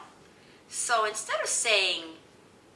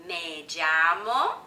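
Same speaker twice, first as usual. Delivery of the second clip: half-speed and louder, rising at the end; drawn-out teaching demo, left hanging; slow model phrase; 4 semitones lower, 6 dB louder, much slower than usual. Shown as a demonstration. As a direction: stretch it out for learners to copy. Models phrase slowly for repetition.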